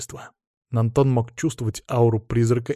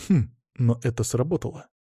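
ordinary delivery, nothing marked

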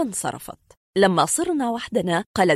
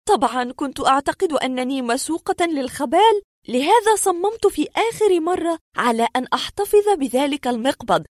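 higher-pitched woman's voice recounting an uncanny experience; distressed woman patient; 10 semitones higher than usual. Acted. Woman tells doctors of the strange door, high earnest voice. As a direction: play the patient pleading her strange story.

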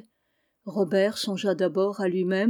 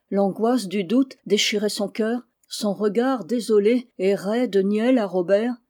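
ordinary delivery, nothing marked

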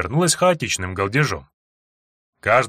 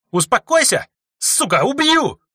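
бешеная угроза; 14 semitones higher, 4 dB louder, slower than usual. Acted. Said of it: "она взъярилась ещё больше".

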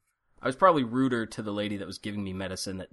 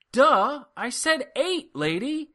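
retold exasperation; 13 semitones higher, much slower than usual. Patient who said eight answers the nurse, exasperated. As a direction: throw the obvious back at her.

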